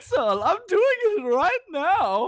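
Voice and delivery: put-on hammy German accent; bad German villain voice, high and slow; movie villain German accent. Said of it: "I'm not gonna do a German accent".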